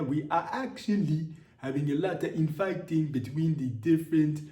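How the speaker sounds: spokesman's defensive statement in a put-on Nigerian accent. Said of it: "turn into Nigerian French".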